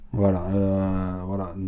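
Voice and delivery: ordinary delivery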